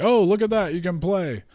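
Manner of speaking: high, sneering mock taunt; lightly voiced gangster boss, high and mocking. Gangster boss taunting the injured player, mock high sneer.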